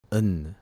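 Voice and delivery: "un" said as one short unstressed syllable; reduced and. Shown as a demonstration. Teacher isolates the reduced sound, short un. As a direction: say "un" quick and light, no d. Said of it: "I pronounced the word and, A N D, as".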